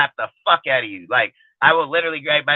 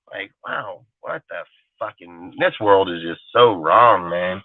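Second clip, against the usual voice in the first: disgusted mutter; 8 semitones lower, slower than usual. Real, not acted. Disgusted aside about the world.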